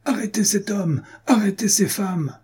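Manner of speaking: high-pitched urgent command, narrated; imperious cry. A narrator's reading. Lady seeing her prey escape, crying out orders. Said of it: "cria de nouveau madame Digomère".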